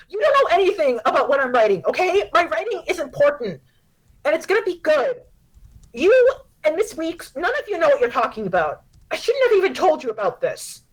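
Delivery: high, loud and very fast; a teen snapping at her mom before storming off; rapid, furious daughter; angry outburst. Acted.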